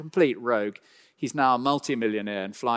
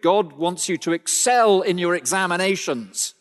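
loud, higher mock American preacher voice; hammed-up American accent, louder and higher; 6 semitones higher, 6 dB louder than usual. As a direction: ham up an American prosperity preacher. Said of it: "That's my American accent".

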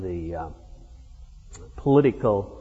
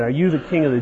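quick, raised, half-mocking "Are you the king"; half joking, half mocking; 3 semitones higher, 4 dB louder, much faster than usual. Shown as a demonstration. Preacher voicing Pilate's half-mocking question to Jesus, lightly sneering. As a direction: toss it off as a mild sneer. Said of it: "Almost done in a half joking, a half mocking way".

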